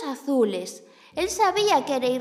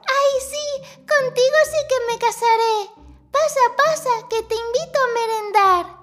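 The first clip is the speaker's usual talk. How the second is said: high, bright-eyed little mouse dreaming of treats; vain little mouse voice; 9 semitones higher, 6 dB louder, slower than usual. Acted. The vain little mouse thinks aloud about her coin, in a high voice.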